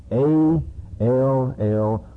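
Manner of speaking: A L L hammered home; spelling out all. Shown as a demonstration. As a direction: punch out each letter of A L L. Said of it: "all sin".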